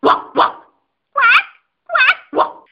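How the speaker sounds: high barks, timid then loud; a caught-out dog barking sheepishly; bashful dog barking. Acted. Dog barking ashamed in front of the doctor, high. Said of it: "آقا سگه با خجالت گفت".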